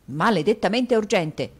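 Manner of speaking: a vain fop fuming theatrically; foppish Mantalini. Acted. Dandy Mantalini cursing at the door bell, exasperated.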